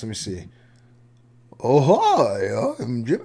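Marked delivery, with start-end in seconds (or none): 1.6-3.2 half-hearted corny impression; a hesitant go at a goofy put-on greeting; Jimmer voice attempt. Shown as a demonstration. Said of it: "I wanna try a Jimmer voice".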